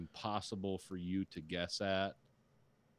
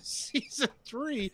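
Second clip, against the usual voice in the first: mock excited; 3 dB louder, much slower than usual. Mock fan voice continues.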